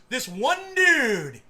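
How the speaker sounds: "dude" stretched long, high and loud; stress on DUDE. Retold or imitated. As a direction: draw out "dude" in high disbelief. Mocks article's single source.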